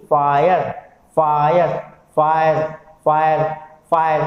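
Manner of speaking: "फायर" repeated as separate words, final E silent; repeated pronunciation. Shown as a demonstration. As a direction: drill "फायर" clearly, each one ending on R. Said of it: "केवल एक sound आ रही है". ("फायर" बार-बार, आख़िरी ई चुप)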